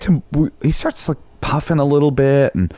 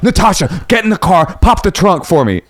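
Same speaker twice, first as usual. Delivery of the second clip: urgent orders; 8 semitones higher than usual. Retold or imitated.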